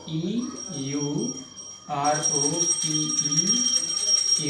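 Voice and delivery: slow letter by letter spelling of E U R O P E; teacher spelling out a word slowly for students. Shown as a demonstration. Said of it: "जैसे लिखा है".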